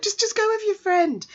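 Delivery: very high urging plea; pleading urging. Real, not acted. Fan urging the boy to choose his best friend, very high. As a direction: beg him to pick his friend. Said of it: "speaker pleads with the character".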